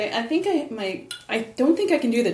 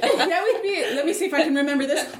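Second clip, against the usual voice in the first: ordinary delivery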